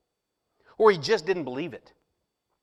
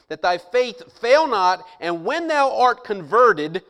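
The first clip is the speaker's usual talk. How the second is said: slow, louder King James reading; slow emphatic reading; 7 dB louder, slower than usual. Acted. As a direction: draw out "fail not". King James verse read slowly.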